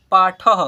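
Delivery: पाठः said loudly as paa-thaha; visarga ending. Shown as a demonstration. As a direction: punch out पाठः with a final aha. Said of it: "अह है last में". (पाठः में विसर्ग का साफ़ उच्चारण)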